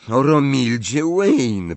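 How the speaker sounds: ordinary delivery, nothing marked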